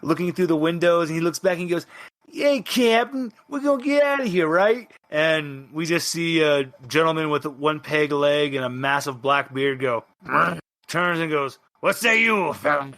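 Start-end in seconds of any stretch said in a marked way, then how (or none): 2.3-4.9 put-on high, hopeful young crew voice; eager young sailor asking. Player character calls to captain. 11.8-12.9 put-on slow gruff old pirate. Bearded peg-leg pirate speaks.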